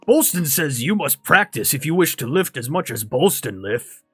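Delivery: circus strongman with bouncing, emphatic intonation; Bolston. Acted. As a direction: lecture proudly in a put-on strongman voice. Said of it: "and he says".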